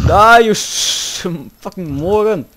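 loud, high, drawn-out battle yell; angry shout. Real, not acted. Player attacking an enemy in game, angry shout.

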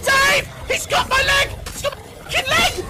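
high-pitched frantic yelling; panicked screaming. Pretend. Player attacked by a game crocodile, screaming in panic. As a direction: scream for help as if being mauled.

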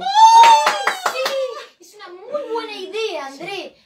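very high, loud squeal; delighted squeal. Acted. Clown squealing with delight at the alien's plan.

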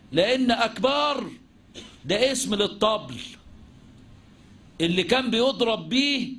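normal talking voice

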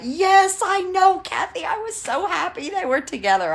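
high-pitched gushing; excited agreement. Real, not acted. Vlogger agreeing with Kathy about a TV couple, excited. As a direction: squeal along with a fellow fan. Gushing with viewer over show.

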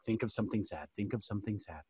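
very soft, low self-coaching; acted under-the-breath mantra; suppressing a laugh. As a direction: mutter it to yourself urgently. Muttering to self to avoid laughing.

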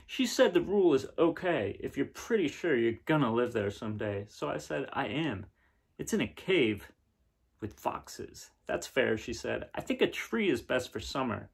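gentle storybook reading, a bit brighter; reading to children. Acted. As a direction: read the picture book aloud to kids. Reading picture book aloud.

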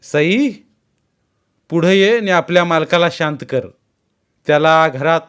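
loud, high stern command; commanding order. A narrator's reading. Mother-in-law ordering the bride, loud and stern. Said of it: "सासूनं दुसरा हुकूम सोडला".

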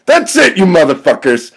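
high, loud angry yell; enraged shout; furious outburst. Acted. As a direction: explode as you start a fight. Kid snaps and attacks them.

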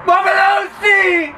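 high, drawn-out showman cry; showman shout. Acted. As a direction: announce yourself like a stage magician. Magician's grand self-announcement.

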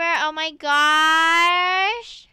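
very slow, drawn-out, loud oh my gosh; stretched amazement. Real, not acted. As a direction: draw it out in disbelief. Amazed at finding the fidget.

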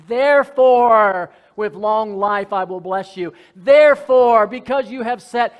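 loud, building preaching cry; full-force preaching. Acted. Pastor preaching to the church about God's love, loud and fervent. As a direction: preach it out to the back row.

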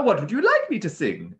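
high, loud, obliging question; eager parrot. A narrator's reading. Parrot asking the beast what to sing, eagerly.

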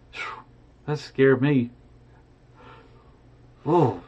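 plain reading voice

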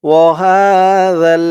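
ওয়াহাযাল with a soft, thin যা, tongue tip at the teeth; soft thin zal. Shown as a demonstration. Teacher showing the soft zal sound, slow chant. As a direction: stretch it slowly, zal soft and light. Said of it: "জালের উচ্চারণটা একদম নরম পাতলা করতে হবে".

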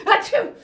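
sharp loud hammed-up sneeze. Grandma sneezing mid-story, loud theatrical sneeze. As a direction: burst out a big fake sneeze.

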